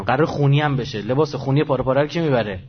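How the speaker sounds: usual speaking voice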